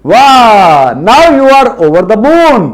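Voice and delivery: slow, high and delighted; playful praise. Acted. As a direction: stretch it out in mock delight. (धीरे, खुश होकर ऊँचे सुर में)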